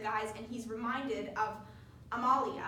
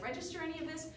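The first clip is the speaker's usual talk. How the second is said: ordinary delivery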